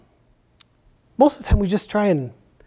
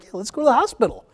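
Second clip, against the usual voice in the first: higher-pitched mock enthusiasm, rising to the end; eager agreement; 8 semitones higher than usual. Firefighter imitating a won-over patient, eagerly agreeing. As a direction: voice a suddenly willing convert.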